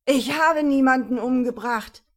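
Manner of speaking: loud bellowed denial; desperate bellow. A narrator's reading. Pinned suspect roars his innocence at the cops, bellow. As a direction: roar your innocence from the ground. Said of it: "brüllte es".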